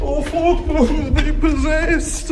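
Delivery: high slow panicked shout; terrified shout. Acted. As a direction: scream in horror at her. Sees possessed mum.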